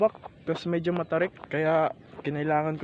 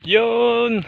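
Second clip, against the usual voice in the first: excited shout; 9 semitones higher, 7 dB louder than usual. Real, not acted. Reached the top.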